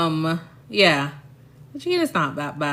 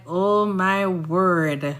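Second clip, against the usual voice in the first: slow, drawn-out Oh, my word; exasperated aside; much slower than usual. Retold or imitated. To puzzled locals, a slow exasperated sigh of words. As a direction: let it out slowly, weary and amused. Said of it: "And I'm like".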